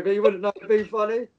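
ordinary talk

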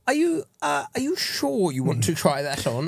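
hesitant, politely worried clerk; nervous shop assistant. Pretend. Employee nervously asking a big customer, hesitant and polite. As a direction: ask it like a sweating shop clerk, high and unsure. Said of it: "employee role-play in shop".

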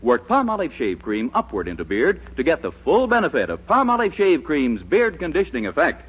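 normal talking voice

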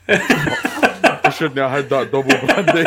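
slow, laughing mock-rueful aside; regretful footballer. Footballer mocking his own half-time brandy, slow and laughing. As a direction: drawl it out like a player regretting his drink. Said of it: "he's like".

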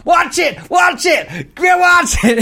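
high, shrill-free mock yell of a panicked woman; mock shouting woman. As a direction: yell it like a frantic dog owner. Woman yelling at dog park.

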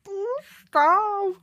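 high, loud giddy squeal; excited squeal. Real, not acted. Giddy about her birthday, loud high squeal.

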